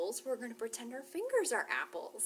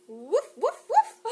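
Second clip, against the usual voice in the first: high playful woof, woof; dog bark; 11 semitones higher, 11 dB louder, slower than usual. Acted. Librarian barking like a dog for the kids. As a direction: bark like a cheerful puppy.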